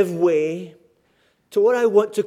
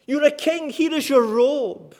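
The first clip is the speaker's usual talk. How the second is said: mock homage, pitched up; higher, scornful jibe without shouting; 6 semitones higher than usual. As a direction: taunt him with a pretend honour. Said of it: "They were mocking him".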